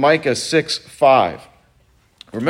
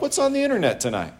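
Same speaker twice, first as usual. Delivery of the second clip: sneaky temptation; 8 semitones higher, 4 dB quieter, faster than usual. Retold or imitated. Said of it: "we're like".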